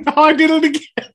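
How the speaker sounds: high, loud laughing admission; laughing at himself. Real, not acted. Host laughing after butchering guest's name. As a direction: laugh it out, embarrassed.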